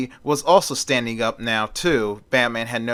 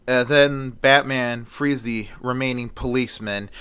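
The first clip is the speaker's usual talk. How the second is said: ordinary delivery, nothing marked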